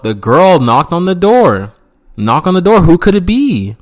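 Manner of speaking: storyteller hamming up the suspense; mock suspense. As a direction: tell it like a thriller. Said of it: "suspense build".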